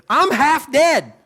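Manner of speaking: normal talking voice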